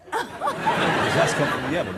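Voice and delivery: slow, drawn out through laughter; savouring the pun while laughing. Real, not acted. Host laughs at own pun.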